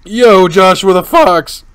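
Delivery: loud, high, drawn-out hail; shouted greeting. Real, not acted. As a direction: call out slowly at full volume. Calls out a greeting to viewer.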